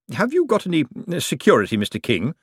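high prissy cashier voice; Bent, prim cashier. A narrator's reading. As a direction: question the client like a clerk.

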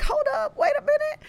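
high-pitched mock disbelief; retold amazement. Host recalls seeing celebrities follow the guest, high-pitched amazement.

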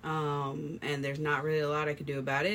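ordinary talk

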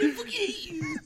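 high cracking shout through laughter; laughing mock cry. Waitress cursing Ruben, high laughing mock shout. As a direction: wail it half crying, half laughing.

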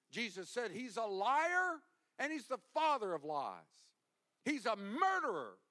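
loud, high, driving sermon delivery; fiery preaching. Real, not acted. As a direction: warn the church about the enemy. Preaching at full force.

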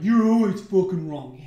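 loud imitation of an angry critic; mock-accusing outburst; mock heckler. Imitates critics attacking him.

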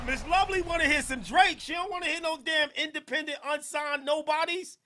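high, loud teasing proclamation; loud mock outrage. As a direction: crow it mockingly, loud and high. Mocking the caller's song request.

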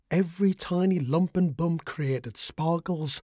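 ordinary delivery

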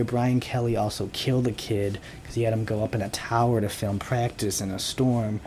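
normal talking voice

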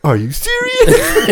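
very high, slow, laughing disbelief; mock disbelief. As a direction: squeal it in hammed-up disbelief. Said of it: "he's like".

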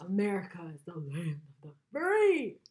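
loud, hammed-up patriotic declaration; mock patriotic boast. Mocks defensive patriots.

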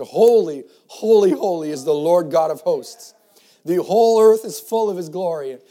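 loud proclaiming cry; seraphim crying out. A narrator's reading. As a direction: cry it out across heaven. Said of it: "they cried one to another".

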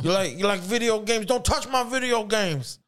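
high, strained, hammed-up dealer voice; touchy dealer. The dealer to a visiting buyer, touchy and high-strung.

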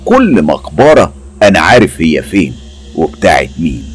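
ordinary delivery, nothing marked

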